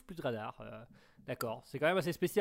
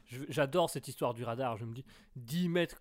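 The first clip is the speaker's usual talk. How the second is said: usual speaking voice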